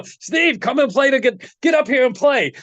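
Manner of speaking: high, playful call across the stage; calling Steve up. Retold or imitated. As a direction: call him up high and bright, mock Les.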